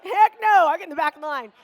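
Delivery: loud, high "heck no"; mother joking she'd flee to the back; emphatic refusal. Retold or imitated. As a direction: refuse it flat out. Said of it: "I'd be like".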